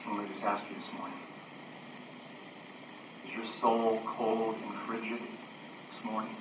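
low, soft pastoral appeal; hushed, searching preaching. Acted. Preacher asks the congregation about cold souls, hushed preaching. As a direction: ask it quietly, with long pauses.

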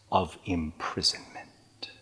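hushed breathy close of the verse; whisper. Acted. Preacher ends the verse in a whisper to the listeners. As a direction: breathe the last words out.

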